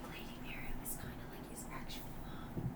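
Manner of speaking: hushed aside to the table; whispered player aside during a tense scene. Real, not acted. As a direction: lean in and whisper the theory.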